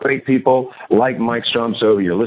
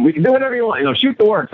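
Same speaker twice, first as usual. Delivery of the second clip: higher, bright generous offer to his bride; eager promise; 10 semitones higher, faster than usual. Retold or imitated. Groom promising his new bride any honeymoon she wants, eager and higher. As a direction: sweep her off her feet with a lavish promise.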